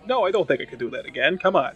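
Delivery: ordinary delivery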